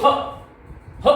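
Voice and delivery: short breath-burst ह from the throat; visarga sound. Shown as a demonstration. Teacher isolates the visarga sound, ह as short "ha". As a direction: push out ह as one short throaty "ha". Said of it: "इसकी जो ध्वनि आती है".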